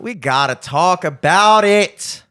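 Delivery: loud, slow, drawn-out declaration; mock-dramatic outburst. Host launching the traffic topic, loud mock-dramatic insistence. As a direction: belt it out, stretching every word.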